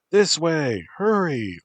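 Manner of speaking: high, loud urgent call; woman shouting. A narrator's reading. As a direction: shout them toward safety. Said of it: "a woman shouted".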